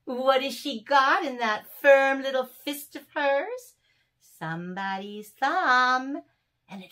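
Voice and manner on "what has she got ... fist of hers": plain reading voice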